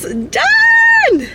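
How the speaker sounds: high, loud victory yell; triumphant shout. Real, not acted. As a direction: shout it out, finish line joy. Crossing marathon finish line.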